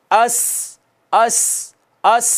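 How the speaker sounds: अस with a sharp whistling s; demonstrating whistling s; whistled s. Shown as a demonstration. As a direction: hiss the s of अस clearly. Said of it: "सीटी है".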